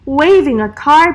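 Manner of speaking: ordinary delivery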